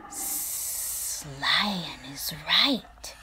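slow, unvoiced hissing; hissing Snake. Snake agreeing with Lion, slow hiss. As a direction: stretch the S like a snake. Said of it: "hissed Snake".

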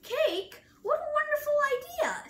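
high, loud, rushing excitement; delighted Elephant. A narrator's reading. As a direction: squeal with delight at the very thought. Elephant delighted by mention of cake.

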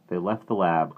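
ordinary delivery, nothing marked

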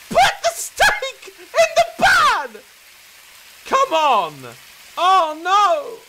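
frustrated yell. Real, not acted. Gamer yelling at the steak, furious slow shout. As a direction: bellow the order.

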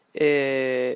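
ordinary delivery

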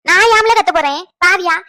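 loud, offended retort; indignant outburst. Acted. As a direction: burst out in protest. Acted quarrel, denies shouting.

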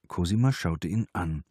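plain reading voice

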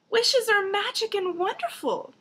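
very high, dreamy princess voice; cartoon princess. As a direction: gush like a starry-eyed princess. Princess praises wishes.

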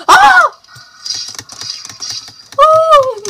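high, loud shriek; startled scream. Real, not acted. Player under attack in the game, screaming.

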